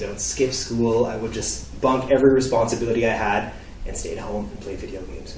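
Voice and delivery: normal talking voice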